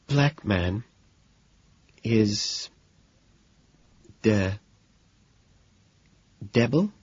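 soft, word by word with long pauses; hushed, bewildered reading; sighing, faltering. A narrator's reading. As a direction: falter through it, lifting to a question at the end.

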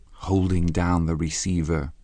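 plain reading voice

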